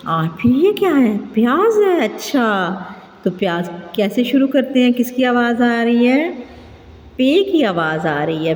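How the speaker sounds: ordinary talk, nothing marked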